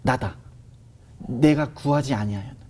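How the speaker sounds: lightly voiced, hushed divine presence; voice of God. God's voice heard in testimony. (낮고 조용하게 엄숙한 하나님의 음성)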